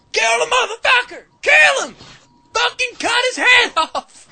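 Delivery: high-pitched screaming crowd of women; enraged yelling. Acted. Reserved women who cannot take it, screaming at the fighter. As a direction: scream for blood, high and loud. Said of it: "they cannot take it".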